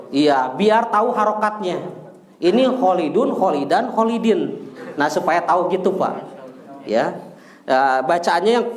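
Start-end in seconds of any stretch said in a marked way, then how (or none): 2.8-4.6 "Khalidun, Khalidan, Khalidin" with each ending fully sounded; voiced case endings. Shown as a demonstration. Teacher showing students the endings, fully pronounced. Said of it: "dibaca harokat akhirnya".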